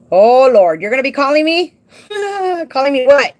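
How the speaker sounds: loud, high, laughing through the words; cracking up at a viewer's joke; laughing outburst. Real, not acted. Laughing at Daryl's teasing comment, loud and high.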